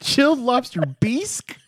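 high, incredulous echo with "bisque" as "beesk"; "bisque" stretched to a high "beesk"; mock mispronunciation. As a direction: question it, saying "bisque" as "beesk". Mimics friend's mispronunciation.

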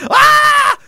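sudden high yelp of panic; panicked scream. Acted. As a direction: shriek like a startled, cornered person. Panicked person screams at confrontation.

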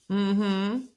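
usual speaking voice

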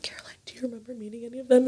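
quick hushed whisper to a friend. Retold or imitated. She secretly asks Caroline about the strangers, whispering fast. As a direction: whisper it so they can't hear.

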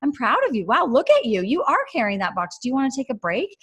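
high, light encouraging voice of the Lord; cheerful encourager. Retold or imitated. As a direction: play a loving father cheering on a small child. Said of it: "it's just like".

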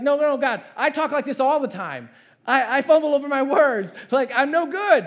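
high, loud flustered protest; nervous excuses. Acted. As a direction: blurt it out, acting a flustered Moses. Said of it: "I'm sure he's stumbling in front of God".

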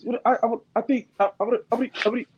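high, stammering, flustered rush of I, I, I; Shady McCoy impression. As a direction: stutter and trip over the start, then scold loudly. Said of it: "My, my best Shady impression".

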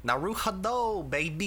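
mock Japanese-accented Brooklyn catchphrase. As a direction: do a Japanese actor attempting Brooklyn talk. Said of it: "Japanese dude trying to do a fucking Brooklyn accent".

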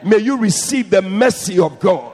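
loud preacher's blessing; blessing shouted from the pulpit. Real, not acted. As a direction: call it out over the crowd.

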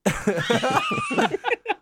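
real laughter. Real, not acted. Laughing at the C-bomb.

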